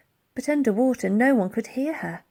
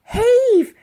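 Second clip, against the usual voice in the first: high loud command; ordering; 13 semitones higher, 10 dB louder than usual. Acted. Elsie ordering the old soldiers to pull, loud. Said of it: "she ordered".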